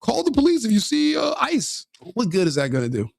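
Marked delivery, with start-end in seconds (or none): 0.0-1.8 mocking imitation. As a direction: parody the officers, voice raised high.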